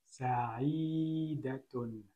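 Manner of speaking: sa'idatun stretched out very slowly; slow blending of syllables. Shown as a demonstration. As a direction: draw out sa'idatun for the class. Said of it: "Donc si je lis".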